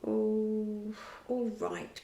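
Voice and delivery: ordinary delivery, nothing marked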